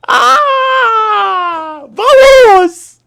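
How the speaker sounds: very high, loud cry of Vamos; ecstatic shout. Real, not acted. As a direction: let it all out in joy. Overjoyed celebration.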